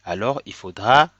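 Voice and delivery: ordinary delivery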